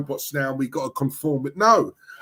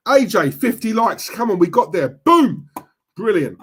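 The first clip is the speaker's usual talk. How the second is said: rising to a louder, higher boom; excited cheer; 6 semitones higher, 5 dB louder than usual. Real, not acted. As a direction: celebrate the likes milestone, punching boom. Celebrating fifty likes on stream.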